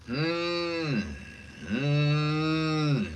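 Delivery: sustained hum gliding up and down; smooth humming exercise; vocal warm-up hum. Shown as a demonstration. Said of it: "Warm ups are quite fun".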